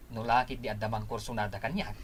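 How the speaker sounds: ordinary talk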